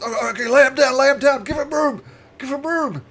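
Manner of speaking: high, loud, urgent orc voice. Acted. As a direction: urge everyone back as the orc. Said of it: "And the orc says".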